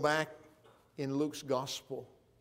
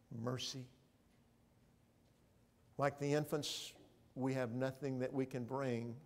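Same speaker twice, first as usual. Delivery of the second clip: ordinary talk, nothing marked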